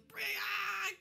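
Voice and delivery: a high, loud goblin screech; goblin battle cry. Acted. Goblin screeching at Adara for slaying his friend. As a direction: shriek it like a furious little goblin. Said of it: "And he goes".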